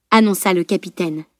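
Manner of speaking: ordinary delivery, nothing marked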